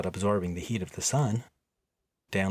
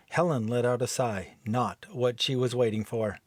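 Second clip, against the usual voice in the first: plain reading voice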